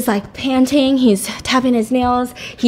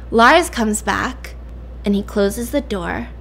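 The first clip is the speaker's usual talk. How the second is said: plain reading voice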